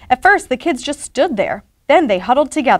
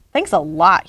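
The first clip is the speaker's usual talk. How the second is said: lower, lightly growled sarcasm; understated growl; 5 semitones lower than usual. A narrator's reading. Pete to Ralph after losing his hat, low mild growl. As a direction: drop the pitch for a mild growl. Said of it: "he growled".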